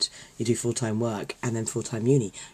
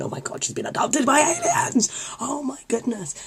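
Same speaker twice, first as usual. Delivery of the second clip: high-pitched mock shock; shocked realisation; 12 semitones higher than usual. Fan suddenly realising the plot twist, high acted shock. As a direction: exclaim it way up high, aghast.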